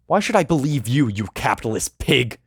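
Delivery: high, loud angry yell. Acted. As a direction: yell it in his face. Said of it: "I yell".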